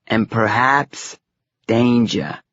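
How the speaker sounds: slow "perhaps", a pause, then "danger" set apart; emphatic pause. A narrator's reading. Fortune-teller warns of danger, pausing before "danger" for emphasis. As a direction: hold back "danger" with a dramatic pause. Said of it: "she paused, doing the old emphasis thing".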